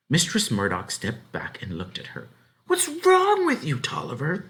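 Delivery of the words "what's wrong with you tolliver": very high, loud bewildered cry; cried out. A narrator's reading. Farm wife startled as the child struggles free, crying out. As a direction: shout it after her, baffled. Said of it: "she cried".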